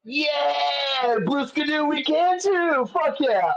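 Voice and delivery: high, bright-eyed yelp of recognition; excited. Real, not acted. Replying to the host about the kids' show, excitedly. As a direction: answer the question with a burst of glee.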